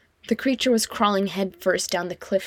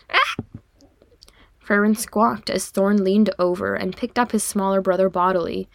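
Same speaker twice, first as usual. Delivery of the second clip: ordinary delivery, nothing marked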